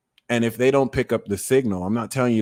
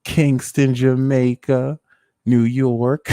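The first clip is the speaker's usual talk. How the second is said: ordinary talk, nothing marked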